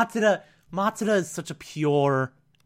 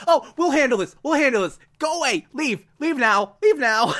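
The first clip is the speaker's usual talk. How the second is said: Misa shooing; 8 semitones higher than usual. Retold or imitated. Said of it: "And Misa's like".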